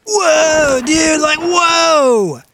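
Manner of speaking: loud high shout from afar; yelling across the room. Acted. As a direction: call out in shocked excitement. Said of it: "from the other room, you hear".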